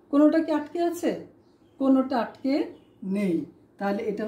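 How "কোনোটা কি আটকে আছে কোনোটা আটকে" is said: ordinary talk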